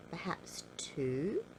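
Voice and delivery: ordinary delivery, nothing marked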